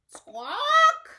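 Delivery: very high loud squawk; parrot squawk. Pretend. Teacher squawking a parrot call for children. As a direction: screech like a parrot.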